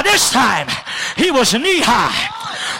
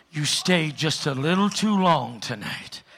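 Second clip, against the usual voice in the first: low menace; 6 semitones lower than usual. Acted. Preacher speaking to the giants, low and threatening.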